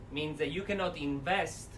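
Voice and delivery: usual speaking voice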